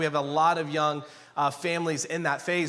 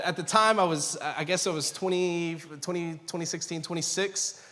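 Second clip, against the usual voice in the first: ordinary talk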